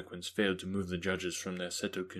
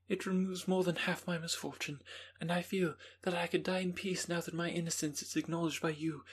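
put-on young Justine; 9 semitones higher than usual. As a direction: voice Justine as a calm young woman.

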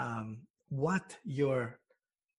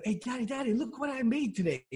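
put-on higher-pitched excited child calling to Daddy; little kid; 8 semitones higher, faster than usual. Little kid showing Daddy what they made, high and eager. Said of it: "I'm a little kid".

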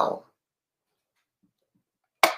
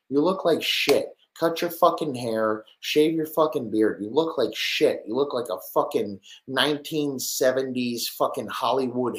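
higher, louder disgusted ranting; higher, louder fan roasting a player; mocking rant; 6 semitones higher, 3 dB louder than usual. Real, not acted. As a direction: tear into him like a fed-up fan. Ranting insults at a player photo.

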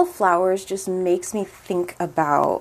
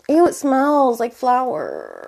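higher, pained ew at a smell; disgusted ew; 5 semitones higher than usual. Real, not acted. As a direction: recoil from the smell. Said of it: "I fucking hate flowers".

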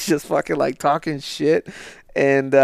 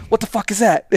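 mock-startled outburst, pitched up; higher, baffled comic swearing; Bill Burr ranting; 7 semitones higher than usual. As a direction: imitate a comedian interrupting his own rant. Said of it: "just fucking going to town on".

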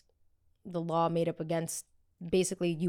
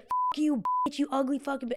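mock boyfriend yelling; 8 semitones higher than usual. Boyfriend cursing on the phone.

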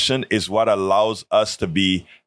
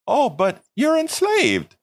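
mocking quote; 14 semitones higher than usual. Voicing someone's mocking claim.

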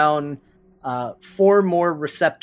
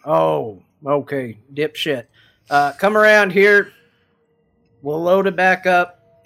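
louder barkeep calling the gnome over; gruff bartender; 3 semitones higher, 8 dB louder than usual. Acted. Barkeep calling the gnome round the bar, loud and gruff. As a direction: call across the bar.

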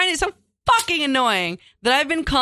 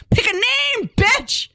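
exasperated; 13 semitones higher, slower than usual. Pretend. Mock exasperated outburst.

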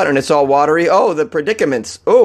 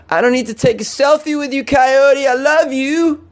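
zoned-out stoner; 3 semitones higher than usual. Pretend. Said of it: "you just go".

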